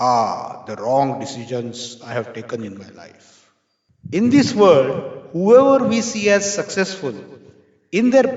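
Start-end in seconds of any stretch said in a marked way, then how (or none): 0.1-3.3 much lower voice for the industrialist; deep-voiced industrialist answering with a smile; renowned industrialist, smiling. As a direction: drop low and reply as the seasoned industrialist. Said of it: "Industrialist smiled".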